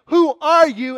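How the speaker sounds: loud, high startled question; acted scared cry. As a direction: call out to a blinding unknown presence. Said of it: "he's scared to death".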